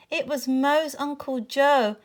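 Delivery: plain reading voice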